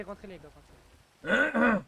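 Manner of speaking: loud, slow call to get inside; calling out loudly. Acted. Dealer calls the guys to get in the car, loud slow shout. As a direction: shout it out, stretching the words.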